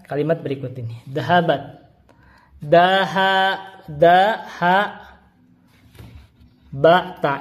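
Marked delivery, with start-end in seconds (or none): 2.6-7.4 daha, daha, ba, ta split into separate syllables with pauses. Shown as a demonstration. Teacher spelling dahabat out slowly syllable by syllable for students. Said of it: "Ini bisa dibaca dahabat".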